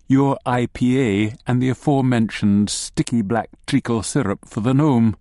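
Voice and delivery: usual speaking voice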